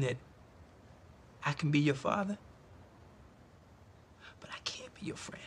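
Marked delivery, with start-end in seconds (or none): 4.4-5.4 breathy whisper. Acted. Father delivers lesson.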